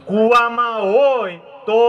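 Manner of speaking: loud, slow, drawn-out proverb opening; loud slow call. Shown as a demonstration. Speaker leads the crowd in a proverb, slow loud call. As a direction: call out the first half slowly so the crowd can finish it. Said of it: "તમે બધા ઊંચા અવાજે આ કહેવત બોલજો".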